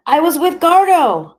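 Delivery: high, loud panicked cry; desperate shout. Acted. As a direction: yell it out while held by the hair. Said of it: "I shouted".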